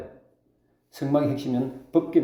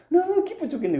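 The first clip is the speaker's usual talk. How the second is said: high-pitched mock burst of joy; overjoyed; 14 semitones higher than usual. Preacher acting out a person so happy they could cry, overjoyed. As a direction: exclaim it as if overcome with happiness. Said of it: "너무 기뻐도 울어요".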